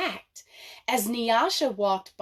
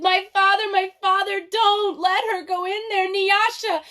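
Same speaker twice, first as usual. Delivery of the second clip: much higher, louder, hysterical sobbing plea; crying, screaming; 10 semitones higher, 6 dB louder than usual. Acted. Manyara begging her father to stop her sister, hysterical screaming. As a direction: scream and beg through tears. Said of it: "she cried and she cried and she said".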